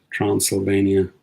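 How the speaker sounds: usual speaking voice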